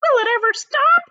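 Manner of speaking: high, loud shout. Acted. Aunt Sponge dancing round the tree, a high loud shout. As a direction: shout it out in glee. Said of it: "Aunt Sponge shouted".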